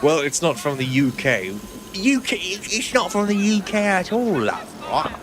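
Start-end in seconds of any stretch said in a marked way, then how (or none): 1.9-5.1 high, hammed-up British voice on UK and at all; mock posh British accent. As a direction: overdo the British accent with a cheeky love at the end. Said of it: "have a hint of where your U K accent is from".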